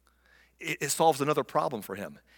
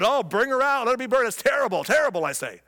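higher, louder mock outrage; loud and proud; 8 semitones higher, 3 dB louder than usual. Judah posing as righteous, loud pompous outrage. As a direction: ham up the righteous indignation. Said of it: "he's all loud and proud".

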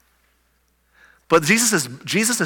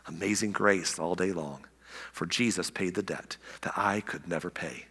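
fading to a whisper; 8 semitones lower, 12 dB quieter than usual. Acted. Reciting the hymn chorus, fading.

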